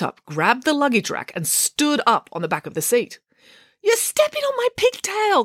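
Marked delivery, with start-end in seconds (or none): 3.8-5.4 very high whiny complaint; high-pitched girlish protest; complaining. A narrator's reading. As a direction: whine it indignantly up high. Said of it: "complained Matilda".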